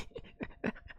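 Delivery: very soft breathy laugh; soft, voiceless chuckle. Real, not acted. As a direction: let a quiet wheeze of laughter out. Still laughing at game scare.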